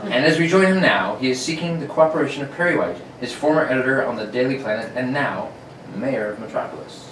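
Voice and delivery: put-on old-time radio narration; radio announcer. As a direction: narrate like a 1940s serial.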